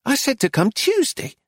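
brisk, high scolding voice; high sharp shopkeeper. A narrator's reading. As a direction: play the bossy gallery owner. Missus Lacy scolding.

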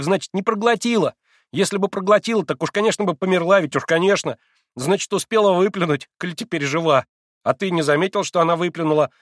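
high, breathless-with-delight explaining; frenzied, delighted exclaiming. A narrator's reading. Boy explaining to his sick friend, elated and insistent. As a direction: rattle out the proof with beaming excitement. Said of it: "восклицал неистово Коля с разгоревшимся и сияющим от восторга лицом".

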